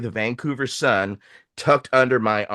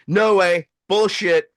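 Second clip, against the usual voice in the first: higher, louder flat-out objection; defiant refusal; 6 semitones higher, 4 dB louder, slower than usual. Retold or imitated. Mentor rejecting the official's deal, raised defiant voice. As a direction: throw the offer back, mildly indignant.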